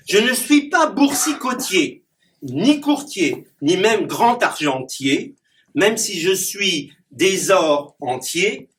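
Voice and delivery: declaimed rhyming verse with punchy rhyme endings; poem recitation. Acted. Clown reciting his rhyming poem to the audience. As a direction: perform the poem for the audience, landing each rhyme.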